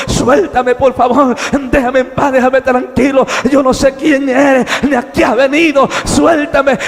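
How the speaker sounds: loud, urgent begging, pitch climbing; desperate pleading. Acted. Man struggling with his captor, desperate pleading. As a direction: plead forcefully to be let go. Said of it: "yo me empiezo a desesperar".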